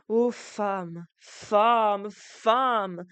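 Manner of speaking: trois « femme » qui montent, de plus en plus fort; un cri de désespoir qui enfle; ton sombre. Acted. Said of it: "dit du ton le plus sombre". (d'un ton sombre, de plus en plus fort)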